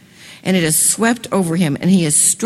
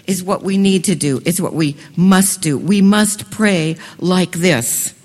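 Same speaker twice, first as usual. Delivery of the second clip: ordinary delivery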